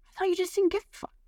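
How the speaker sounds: high, quick imitation of a boyfriend; mock defensive. Boyfriend explaining why he never said anything, high mock defensiveness. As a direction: pitch way up and rush the excuse.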